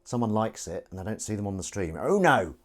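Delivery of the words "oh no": surprised cry. Real, not acted. Streamer sees a like.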